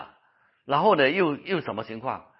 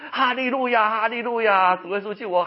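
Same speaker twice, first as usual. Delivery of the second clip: desperate crying out; 10 semitones higher, 7 dB louder than usual. Retold or imitated. Preacher acts out patient clinging to a tree, desperate shouting. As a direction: call on the Lord for rescue. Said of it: "一直喊".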